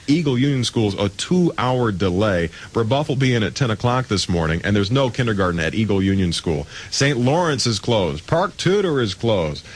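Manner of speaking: ordinary talk, nothing marked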